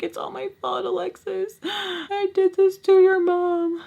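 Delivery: imitating her father's guilt-ridden crying; mock crying dad. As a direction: lightly play the sobbing dad.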